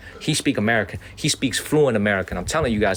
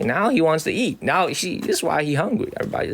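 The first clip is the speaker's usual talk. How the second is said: ordinary delivery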